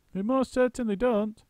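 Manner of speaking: high, chattering monkey; a monkey's quick squeaky voice; Muggle Wump. A narrator's reading. Muggle Wump questioning the roly-poly bird. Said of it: "cried Muggle Wump".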